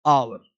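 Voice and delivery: a teacher shows pupils how to say "आवर"; pronunciation demo. Shown as a demonstration. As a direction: model "आवर" clearly without the h. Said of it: "तो इसको हम पढ़ते हैं".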